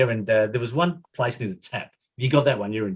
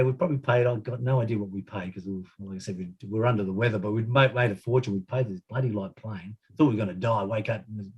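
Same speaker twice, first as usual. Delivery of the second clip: ordinary talk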